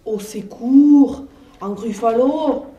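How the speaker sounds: slow, loud hammed-up cry for help; mock terror. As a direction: pretend to panic at the monster. Mouse fakes terror at gruffalo.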